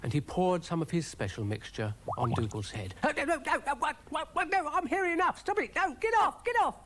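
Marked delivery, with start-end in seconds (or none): 3.0-6.8 very high, frantic gabble; high-pitched panicked yelping of words; frantic protest. Acted. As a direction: squeal and splutter to be left alone. Dougal doused with mixture, protests.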